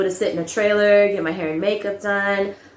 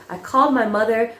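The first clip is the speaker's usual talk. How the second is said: normal talking voice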